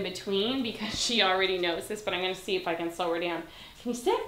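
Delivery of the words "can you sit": high, sing-song ask; high dog-talk question. Real, not acted. Trainer asking her dog to sit. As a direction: lift it way up for the dog.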